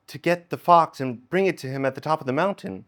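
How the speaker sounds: ordinary talk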